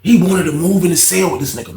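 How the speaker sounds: ordinary talk, nothing marked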